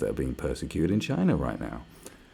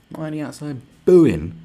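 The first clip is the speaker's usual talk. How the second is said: ranting shout; 7 semitones higher, 10 dB louder than usual. Real, not acted. Ranting at protesters.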